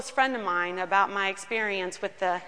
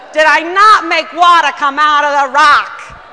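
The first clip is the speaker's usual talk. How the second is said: shouted rebuke, much louder, stress on not; scolding shout; 4 semitones higher, 15 dB louder than usual. Retold or imitated. God rebukes Whitney a second time, shouting. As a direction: repeat it, yelling it home. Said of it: "Brooklyn might be the best way".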